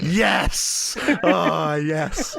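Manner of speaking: high, loud yes easing into laughter; delighted cheer. Real, not acted. Game master relishing a player's heartfelt line, delighted laughing cheer. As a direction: cheer it, then laugh through it.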